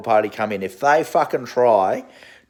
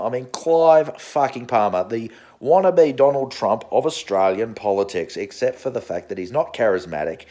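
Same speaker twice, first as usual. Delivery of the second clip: normal talking voice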